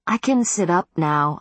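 ordinary delivery

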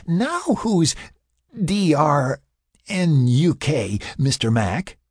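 high, slow spelled-out taunt; tipsy teasing. A narrator's reading. Sarah turning the drunk charge on Mac, teasing taunt. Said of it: "she teased".

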